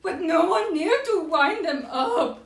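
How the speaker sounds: high, slow hammed-up wail; crying Owl. A narrator's reading. Owl was crying, high slow mock wail. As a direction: draw out the mock grief, high-pitched. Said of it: "Owl was crying".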